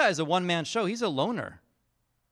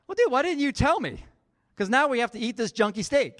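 whiny complaint; 8 semitones higher, 6 dB louder than usual. Retold or imitated. Son complaining to dad.

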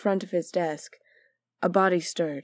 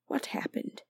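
dazed whisper; 10 dB quieter than usual. A narrator's reading. Prioress waking dazed.

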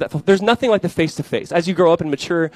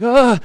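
startled yell; 8 semitones higher, 8 dB louder than usual. Retold or imitated. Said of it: "He's like".

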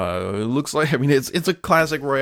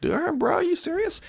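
mock incredulous. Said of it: "And Microsoft was like".

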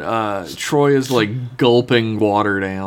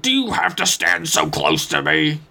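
higher, put-on irritated Worf; lightly acted testy objection; mock irritation; 4 semitones higher than usual. Worf snapping at Riker's check-in, mock irritation.

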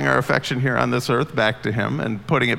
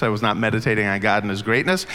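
normal talking voice

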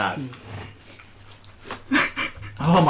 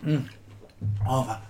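normal talking voice

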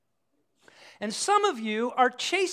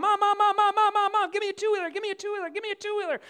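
put-on high, rapid-fire nagging kid; pestering child; 9 semitones higher, faster than usual. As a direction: nag mom in a high, insistent imitation of a child. Acts child pestering mom for bike.

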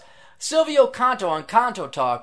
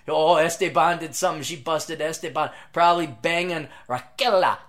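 mock commentary; 7 dB louder than usual. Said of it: "I'm like".

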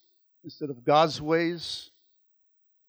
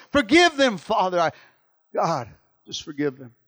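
rising, loud prayer cry; fervent prayer; 5 semitones higher, 9 dB louder than usual. Acted. Pastor prays for the church's loved ones, rising loud. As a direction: plead for each sinner.

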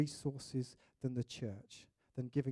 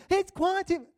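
high, loud shout over roaring water; straining to be heard over the falls; shouting over a waterfall; 11 dB louder than usual. Retold or imitated. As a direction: yell it over the thunder of the falls.

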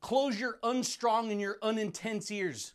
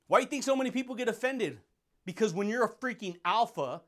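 usual speaking voice

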